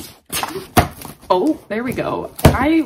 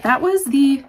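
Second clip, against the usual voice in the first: ordinary delivery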